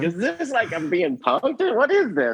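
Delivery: high, laughing am-I-being-punked; startled disbelief. Retold or imitated. Recalling the biker fan asking about Gerbert, high laughing disbelief.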